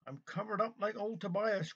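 hint of a complaining parrot; lightly croaked parrot. A narrator's reading. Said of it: "croaked the parrot".

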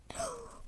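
sharp breathless gasp at the hot taste. Pretend. Doll tastes the hot drink and gasps breathlessly. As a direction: suck in air as the spice hits.

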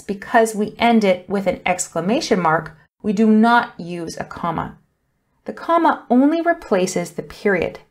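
ordinary talk, nothing marked

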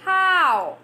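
How stretched with a wide open mouth; open-mouth demo. Shown as a demonstration. Teacher showing students an open mouth on How. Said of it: "Let it open".

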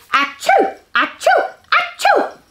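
high, loud pretend sneeze; exaggerated squeaky achoo; mock sneezes. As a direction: sneeze it playfully for the children. Mock sneezes ending the song.

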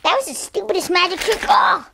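high, fast taunting voice; excited, high-pitched challenge; mock taunt. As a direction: taunt your opponent in play. Taunting opponent in play fight.

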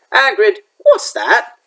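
a hint of a boy's uneasy question; wary question. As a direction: ask slowly, already suspecting. Harry spotting the egg.

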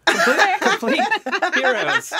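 laughing. Real, not acted. Laughing after declaration.

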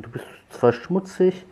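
high loud pet talk. Real, not acted. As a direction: coo at the kitten. Baby talk to kitten.

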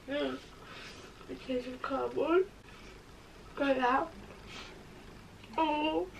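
talking while chewing; muffled by food in mouth; mouth full. Real, not acted. Seen 2: pink-sweater speaker holds bitten cake, appears chewing while talking.

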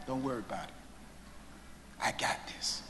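soft whispered promise; son's whisper. Retold or imitated. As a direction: hush it to a crying mother. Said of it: "you just blurt out".

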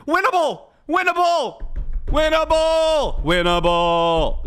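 high loud repeated yell, stretching out; screaming winnable. Retold or imitated. Mocking the Moira player's rally cry, stretched yell.

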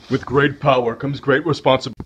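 ordinary talk, nothing marked

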